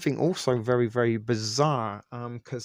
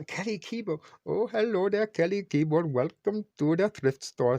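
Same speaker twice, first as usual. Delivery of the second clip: put-on Apu accent; 9 semitones higher than usual. Host welcoming a viewer in a hammed-up Indian shopkeeper accent. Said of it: "in my Apu accent".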